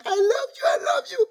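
very high-pitched doting mom; gushing affection. Retold or imitated. Mom telling her kids every minute, gushing.

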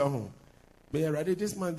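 ordinary talk, nothing marked

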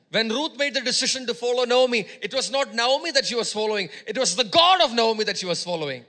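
preaching at full force. Acted. Preacher declaring Ruth's choice to the congregation, full force. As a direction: proclaim it to the whole church.

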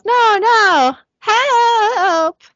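high, loud cry for help; acted yell. Driver crashing through barricade, yelling in mock panic. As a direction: wail it in mock panic, stretching the last word. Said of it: "He yells".

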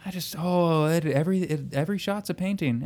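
hushed, airy mockery of an admirer; mock swooning. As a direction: gush softly in fake admiration. Mocking fawning over the director's shots.

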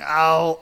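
loud, high "Ow"; cry of pain. Acted. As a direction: yelp like you're speared. Goblin stuck on antlers.

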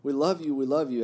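joining in. A narrator's reading. Boys all joining in to Karamazov, loud exclamation. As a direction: read it as a chorus of devotion. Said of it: "everyone joined in".

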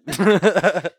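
breaks into loud laughter; laughing. Real, not acted. Laughs at himself.

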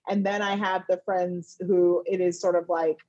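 ordinary talk, nothing marked

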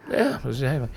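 put-on answering in a high old-timer voice; old person voice. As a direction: slip into an old man voice by accident. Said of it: "that wasn't on purpose".

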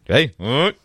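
ordinary delivery

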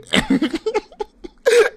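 high burst of loud laughter. Real, not acted. Laughing loudly while telling friend the concert story, high laugh. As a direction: crack up loudly.

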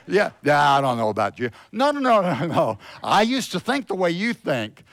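loud, high, fervent preaching. Acted. Pastor preaching to the church, fervent and loud. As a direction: preach it with full force to the congregation.

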